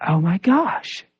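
slow, hushed wonder, rising at the end; humbled amazement. Retold or imitated. Woman finding her knee healed, humbled amazement. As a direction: imitate someone stunned into humility. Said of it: "Now she's humbled".

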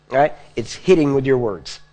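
ordinary talk

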